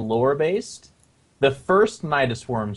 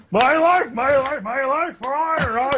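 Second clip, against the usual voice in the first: mock screaming unit; 9 semitones higher, slower than usual. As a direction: wail it like a unit screaming as it spawns. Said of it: "screams when it's made or used".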